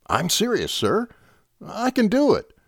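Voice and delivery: put-on young officer insisting to his captain. A narrator's reading. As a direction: plead that you mean it. Lieutenant insisting.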